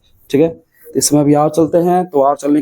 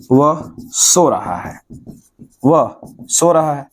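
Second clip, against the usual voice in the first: 1 slow dictation; much slower than usual. Shown as a demonstration. Teacher dictating sentence to class.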